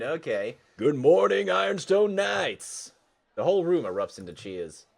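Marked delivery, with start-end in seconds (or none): 0.8-2.9 loud, high, drawn-out rally call; big class-president hello; rousing crowd greeting. Acted. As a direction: holler it to a packed gymnasium.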